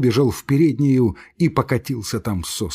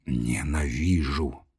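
low, softer, slow, heavy-breathing; hissed hatred; 9 semitones lower, 6 dB quieter, slower than usual. Acted. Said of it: "говорил Лаевский тихо, тяжело дыша".

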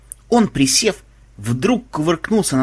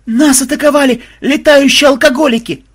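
high, loud panicked scream; shrieking alarm; terrified yell; 13 semitones higher, 9 dB louder than usual. A narrator's reading. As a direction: cry out in sheer horror. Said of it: "В полном ужасе заорали".

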